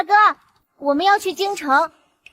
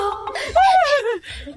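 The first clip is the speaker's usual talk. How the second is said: bright short laugh; light laugh; 9 semitones higher than usual. Acted. Daughter laughs off mother's fear.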